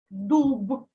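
дуб with a fully voiced b at the end; voiced final consonant. Shown as a demonstration. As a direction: release the б in дуб as a clear "b", never p. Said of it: "Вимовляємо дзвінко".

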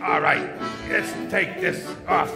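normal talking voice

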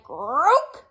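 loud imitated toad croak; big storybook croak. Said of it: "Toad said".

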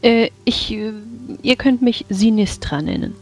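high character voice. Acted. Character introduces herself.

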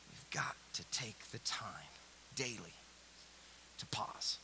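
hushed whispering plea. Real, not acted. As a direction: whisper it close and intimate. Drops to whisper.

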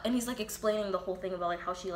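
ordinary delivery

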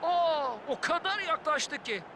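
surprised gasp. Real, not acted. Co-commentator reacts to near miss.

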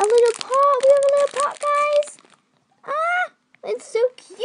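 gasp, then high loud squealing; excited squeal. Real, not acted. Showing viewers a little pot, a high excited squeal.

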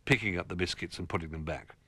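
plain reading voice

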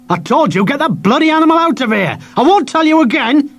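high, loud furious yell; irate shopkeeper shouting; angry shout. Acted. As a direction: lose your temper with the boy. Shopkeeper shouts second time.

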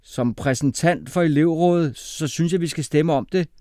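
loud, high, firm interjection; interrupting. A narrator's reading. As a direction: cut in on the argument. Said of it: "afbrød Singh".

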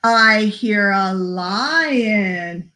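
slow, lilting story reading; picture-book read-aloud. Acted. Librarian reading Polar Bear to children, slow lilting read-aloud. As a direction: read it slowly with singsong emphasis.

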